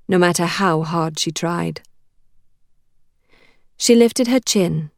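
plain reading voice

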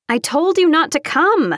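high, exasperated yell; yelling over music. A narrator's reading. Friend scolding Taylor over the party music, yelling. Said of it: "I yelled over the music".

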